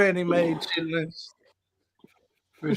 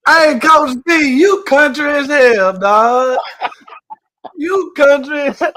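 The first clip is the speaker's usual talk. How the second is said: loud teasing; 6 semitones higher, 6 dB louder than usual. Real, not acted. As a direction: call him out loudly, teasing. Teasing the coach about mothballs.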